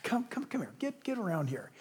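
put-on old Peter softly gathering his scattered flock; tender fatherly call. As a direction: call your children close with a melting heart. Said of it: "he just melts with this fatherly heart".

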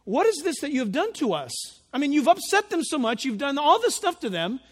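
loud, rushed, acted exasperation; alarmed pleading. Men of Judah confronting Samson, acted alarm.

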